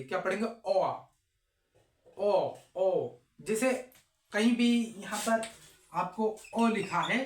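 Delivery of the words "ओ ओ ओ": ओ repeated with rounded lips; teacher modelling ओ for students; repeated o demo. Shown as a demonstration. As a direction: drill a round ओ several times. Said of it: "को क्या पढ़ेंगे?".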